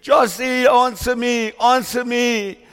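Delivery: acted desperate father's shout, high and loud; high, loud, imploring calls; crying out. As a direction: cry out to a buried son, pleading for a reply. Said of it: "He was crying out to his son".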